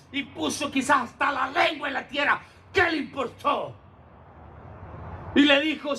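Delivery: fervent shouted sermon; preaching at full force. Acted. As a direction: drive the story home to the congregation. Preaching the healed man's praise.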